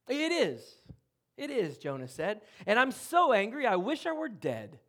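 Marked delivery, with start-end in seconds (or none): 0.1-1.8 higher, louder sulky insistence; a sulky prophet digging in; petulant Jonah. Acted. Jonah sulkily answers God, petulant and insistent. 2.7-4.8 higher, louder sulky insistence; a sulky prophet digging in; petulant Jonah. Acted. Jonah sulkily answers God, petulant and insistent.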